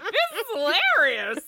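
high, loud, laughing through it. Retold or imitated. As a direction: laugh as you say it. Quoting self, laughing.

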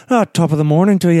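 fast, hammed-up Irish greeting; playful stage-Irish "top of the morning"; a hint of a stock Irish accent; mock Irish. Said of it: "the first thing he says is".

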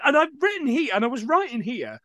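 usual speaking voice

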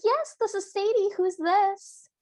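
high-pitched mock phone voice; Sadie. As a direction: imitate a cartoon lady picking up the phone. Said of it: "And she goes".

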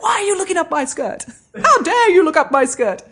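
high, outraged puppet voice with laughter breaking in; indignant Nanny Piggins. Retold or imitated. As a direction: play the offended puppet scolding the kids. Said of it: "It's like".

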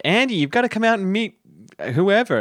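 calling through the door. Retold or imitated. Someone knocking, calling Andy out.

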